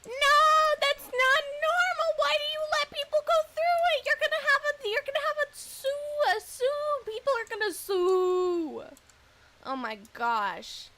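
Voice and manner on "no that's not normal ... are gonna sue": high, loud incredulous protest; indignant outcry. Real, not acted. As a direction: cry out in outraged disbelief. Outraged at the dangerous door story.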